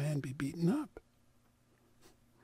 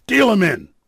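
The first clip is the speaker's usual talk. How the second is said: loud, rough order; gruff command; 12 dB louder than usual. Acted. Burly man orders the others to deal the rabbi in, loud. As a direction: bark it to the card table. Bully forces rabbi into game.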